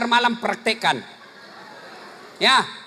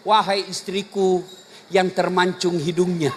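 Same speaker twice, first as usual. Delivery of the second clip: tender address; 7 semitones lower than usual. Shown as a demonstration. Demo of loving address, slow.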